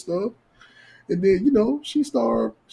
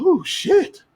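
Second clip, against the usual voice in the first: mock surprised woman; 13 semitones higher than usual. Woman surprised, voiced in story.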